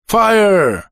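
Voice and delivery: high battle shout in English; shouted command. A narrator's reading. Another British officer taking over, shouting. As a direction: shout the order sharply. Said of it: "закричал другой голос".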